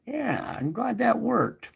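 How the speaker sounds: high, delighted oh yeah; light imitated relief; pleased surprise. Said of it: "And you're like".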